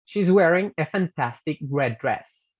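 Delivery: slow model reading, "red dress" linked; model sentence. Shown as a demonstration. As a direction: read it out for the class to repeat. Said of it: "leamos estos ejemplos en una oración". (frase modelo leída despacio)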